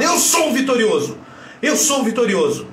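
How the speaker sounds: high, powerful self-affirmation; forceful, victorious tone. Shown as a demonstration. Coach telling viewers to put force in the tone, victorious and strong. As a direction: put strength in your voice and proclaim it. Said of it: "Bota força no tom da sua voz e fala".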